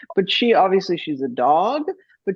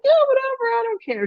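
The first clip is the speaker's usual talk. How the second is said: very high, careless dog voice; animal voice; faster than usual. Acted. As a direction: squeak it out like a laid-back dog. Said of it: "that's my animal voice".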